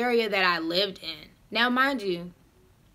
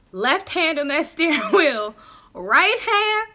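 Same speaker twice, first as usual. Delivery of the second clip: higher and louder in disbelief; outraged emphasis; 6 semitones higher, 5 dB louder, slower than usual. Real, not acted. Telling listeners where his hand went, outraged. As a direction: hit it with disbelief.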